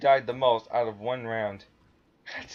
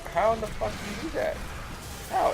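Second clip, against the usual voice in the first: incredulous outcry; 10 semitones higher than usual. Retold or imitated. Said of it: "I'm like".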